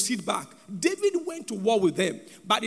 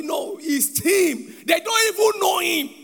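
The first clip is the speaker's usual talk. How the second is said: high, loud burst of disbelief; raised-voice punchline, stretched on the first words; incredulous exclamation; 7 semitones higher, 5 dB louder, slower than usual. Real, not acted. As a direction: cry it out in preacherly exasperation. Mock outrage over crying football fans.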